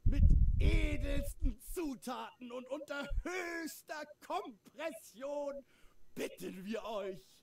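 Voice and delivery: biblical prophet. Acted. Invokes gods of forefathers.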